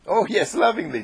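high-pitched gushing agreement; swooning storybook girl. A narrator's reading. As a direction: coo it with exaggerated delight. Jane gushing over lovingly.

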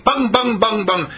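high, punchy rapid-fire bangs. Shown as a demonstration. Preacher shows students how to rattle off affirmations, rapid-fire. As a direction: fire them off quick, high and loud. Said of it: "as quick as you can say them".

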